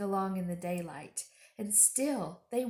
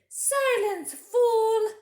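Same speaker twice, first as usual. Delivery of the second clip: much higher, louder furious witch; witch's snap; 14 semitones higher, 11 dB louder, much slower than usual. Acted. Witch silencing Edmund, shrill fury. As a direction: spit it out at the boy. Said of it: "she answered".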